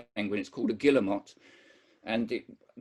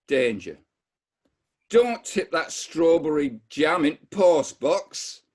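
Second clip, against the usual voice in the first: put-on West Yorkshire accent, slow and firm; a Bradford kid warning his dad, slower; 3 semitones higher, 6 dB louder, slower than usual. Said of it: "I'm gonna try my Bradford accent".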